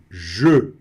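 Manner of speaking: je with a zh and a rounded e; French word je. Shown as a demonstration. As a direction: say je, lips rounded. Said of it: "pronome pessoal eu".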